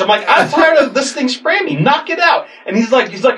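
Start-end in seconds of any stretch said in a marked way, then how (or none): 0.3-2.5 high-pitched re-enacted rant; exasperated demand. Sprayed man urges cop to club skunk, exasperated. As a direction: plead and order at once, pitched way up.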